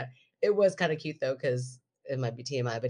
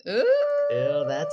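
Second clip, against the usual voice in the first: ew, squealed much higher and louder; shocked, disgusted squeal at the spooky detail; 7 dB louder than usual. Real, not acted. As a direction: recoil with a high squeal of disgust. Reacting to the creepy story.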